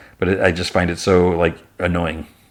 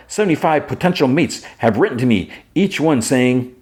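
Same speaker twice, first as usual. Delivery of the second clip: higher, formal, proud alien voice; boastful Kara; 7 semitones higher than usual. Retold or imitated. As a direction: report it grandly in a raised voice, slowing at the end. Kara proudly reports her matches.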